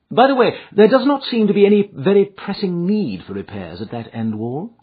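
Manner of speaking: high, pointed detective voice; brisk, questioning sleuth; Holmes, probing. A narrator's reading. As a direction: raise a doubt as if in passing. Holmes probes about the repairs.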